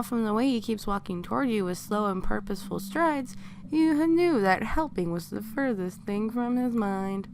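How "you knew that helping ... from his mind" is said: plain reading voice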